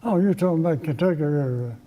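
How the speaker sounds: ordinary talk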